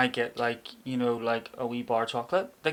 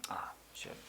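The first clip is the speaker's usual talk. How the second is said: whispered curse; 18 dB quieter than usual. Retold or imitated. Retold family reaction.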